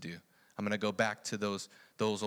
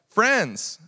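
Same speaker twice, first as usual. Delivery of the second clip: loud, high call across the water; 11 semitones higher, 11 dB louder than usual. Acted. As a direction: call out to the boat. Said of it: "He says".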